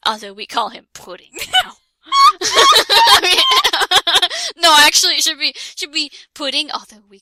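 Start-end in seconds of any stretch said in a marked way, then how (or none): 2.1-4.5 high shrieking laughter. Real, not acted. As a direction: squeal with laughter. Laughing at own reading.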